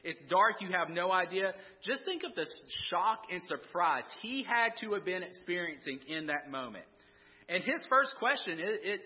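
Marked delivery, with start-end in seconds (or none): none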